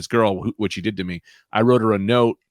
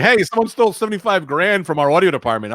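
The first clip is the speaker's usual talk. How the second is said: mock boss voice; 6 semitones higher than usual. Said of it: "be like".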